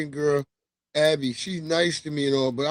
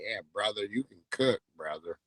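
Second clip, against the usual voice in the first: quiet imitation; 7 semitones lower, 10 dB quieter than usual. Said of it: "And they like".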